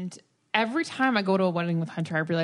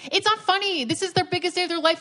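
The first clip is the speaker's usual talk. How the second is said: mock prissy date; 9 semitones higher, faster than usual. Mocking the dates' protest.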